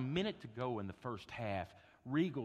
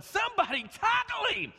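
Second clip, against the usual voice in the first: much higher, loud frantic yell; retold coach yelling from the sideline; 12 dB louder than usual. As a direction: yell to stop the runner. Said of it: "starts yelling".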